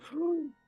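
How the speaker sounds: sudden high gasp for air; high gasp surging back from the brink; sharp gasp. Acted. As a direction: gasp as if coming back to life. Character surges back to life.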